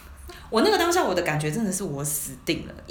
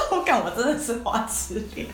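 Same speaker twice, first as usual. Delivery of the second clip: laughing; 3 semitones higher than usual. Real, not acted. Admits it while laughing.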